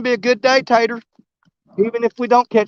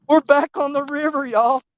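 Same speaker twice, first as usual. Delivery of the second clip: usual speaking voice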